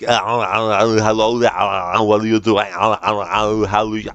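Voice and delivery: slow, exaggerated Austrian accent full of groaning noises; over-the-top Arnie accent. Shown as a demonstration. Showing what he wouldn't do, hammed-up Arnie accent. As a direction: ham up the Austrian accent with long wails between words. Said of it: "I wouldn't be like".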